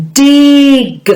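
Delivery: "Dig" stretched out high, long vowel and released g; "Dig" drawn out high so each sound is heard; emphasised phonemes. Shown as a demonstration. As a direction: hold the i in "Dig", then pop the g. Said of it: "really emphasise those words".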